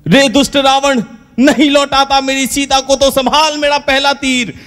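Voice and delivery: stagey heroic shout. Acted. Ram on the village stage, thundering his challenge at Ravan. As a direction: ham it up like a village Ramlila hero.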